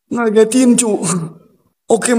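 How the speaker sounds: normal talking voice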